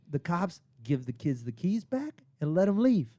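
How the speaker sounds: ordinary delivery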